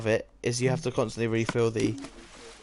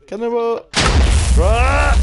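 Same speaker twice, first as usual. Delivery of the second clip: loud excited battle cry; cannonball yell; 11 semitones higher, 14 dB louder, much slower than usual. Real, not acted. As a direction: yell it as you leap. Jumping into hoop, yelling.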